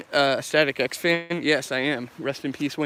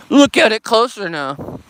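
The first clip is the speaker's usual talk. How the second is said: loud, drawn-out, higher taunt; mock menace; 5 semitones higher, 8 dB louder, slower than usual. Streamer orders viewers to look closer, mock-menacing. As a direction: play the villain, big and slow.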